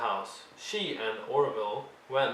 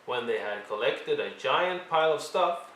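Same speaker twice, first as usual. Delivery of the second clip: ordinary delivery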